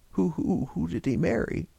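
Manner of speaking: hoarse, stammering demand. A narrator's reading. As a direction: rasp out the question. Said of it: "he demanded hoarsely".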